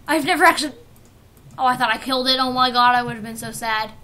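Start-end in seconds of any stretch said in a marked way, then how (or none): none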